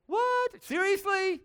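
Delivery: very high, squeaky disbelief; can't-believe-it squeak; mock incredulity. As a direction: act out a stunned, high-pitched protest. Mock reaction to God acting alone.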